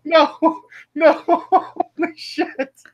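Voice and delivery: high-pitched laughing disbelief. Real, not acted. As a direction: lose it laughing. Helpless laughter at meta joke.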